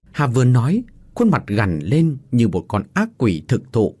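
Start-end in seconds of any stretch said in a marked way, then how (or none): none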